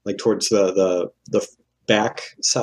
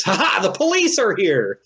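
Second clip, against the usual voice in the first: high, gleeful mock announcement; slightly crazed character cry; a little wild-eyed; 9 semitones higher than usual. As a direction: open on a cackled ha ha and pitch it way up. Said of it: "a little wild-eyed, he says".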